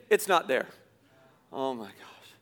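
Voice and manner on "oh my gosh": dismayed gasp. Real, not acted. Realizing stuff is gone.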